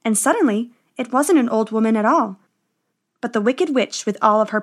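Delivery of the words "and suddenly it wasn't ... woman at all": ordinary delivery, nothing marked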